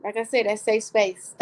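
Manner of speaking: ordinary talk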